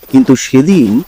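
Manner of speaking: ordinary delivery, nothing marked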